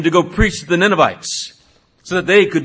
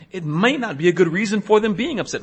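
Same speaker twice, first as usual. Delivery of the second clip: ordinary talk, nothing marked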